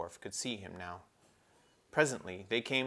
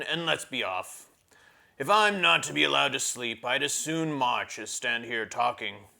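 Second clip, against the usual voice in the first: grumbling dwarf; 4 semitones higher, 9 dB louder than usual. Acted. Trumpkin urging the children on, loud grumpy dwarf. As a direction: bark it like a tired, cross dwarf.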